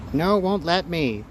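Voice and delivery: ordinary talk, nothing marked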